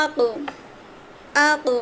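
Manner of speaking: আকো with a sharp knock and rebound on the ক; qalqalah on আকো. Shown as a demonstration. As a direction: bounce the ক of আকো off its point. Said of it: "কী করলাম? তার মাখরাজে ধাক্কা দিলাম". (মাখরাজে ধাক্কা দিয়ে আকো)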